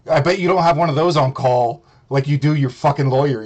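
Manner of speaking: ordinary talk, nothing marked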